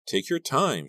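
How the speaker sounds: plain reading voice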